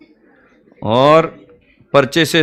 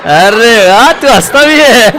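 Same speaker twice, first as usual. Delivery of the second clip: loud, high, laughing mock amazement; mock surprise; 7 semitones higher, 8 dB louder, slower than usual. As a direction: act astonished that he laughs, much higher. (ऊँची, ज़ोरदार, हँसती हुई नकली हैरानी)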